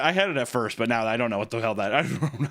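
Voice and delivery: ordinary talk, nothing marked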